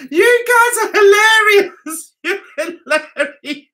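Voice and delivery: loud, high exclamation breaking into laughter; helplessly amused, words giving way to giggles; laughing. Real, not acted. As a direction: cry it out, then lose it laughing. Cracking up at the chat.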